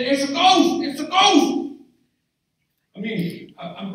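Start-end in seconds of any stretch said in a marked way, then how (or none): 0.0-1.7 high, loud frightened shout; panicked yell of ghost; frightened cry. Acted. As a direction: cry out like a terrified disciple. Disciples cry out in fear.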